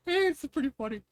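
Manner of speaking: high, laughing comment; real leftover laughter; amused. Real, not acted. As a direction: say it still giggling. Still amused after laugh.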